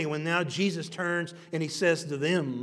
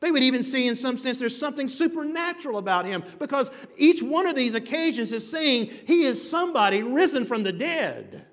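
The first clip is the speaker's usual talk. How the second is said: high, loud preaching at full force; fervent preaching; 9 semitones higher, 6 dB louder than usual. Acted. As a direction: preach it out, lifting the crowd. Preacher rising in fervor.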